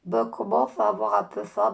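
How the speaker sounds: a light stuffed-up nose voice; imitating blocked nose. Narrator repeating how Lola talks with a blocked nose. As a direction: mimic her blocked nose. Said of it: "elle a le nez bouché".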